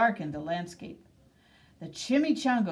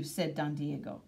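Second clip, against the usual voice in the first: usual speaking voice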